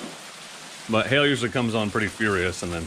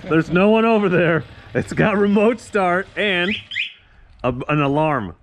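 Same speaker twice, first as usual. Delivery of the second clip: excited; 6 semitones higher, 5 dB louder, slower than usual. Real, not acted. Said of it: "Watch what this does".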